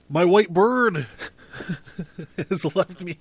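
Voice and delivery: a bitter laugh breaks in. Acted. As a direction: laugh through the words. Laughs at bird's leaving.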